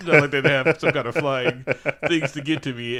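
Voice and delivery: normal talking voice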